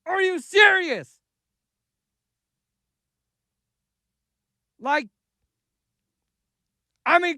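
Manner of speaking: angry rising rant, shouting; building from heated to yelling; escalating comedy rant. Real, not acted. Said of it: "Damn, he's mad".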